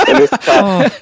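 high laugh spilling into the words; burst of laughter. Real, not acted. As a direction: crack up at your own punchline. Teller cracks up at own punchline.

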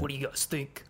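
scruffy Boston tough-guy voice; gruff Boston accent, a touch too light. Retold or imitated. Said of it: "if you're gonna mimic C T, put some scruff in your voice".

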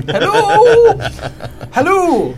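high, drawn-out comic holler; calling out. Acted. Player calling from the window to a fleeing maid, high hammed-up holler. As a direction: holler after someone far away.

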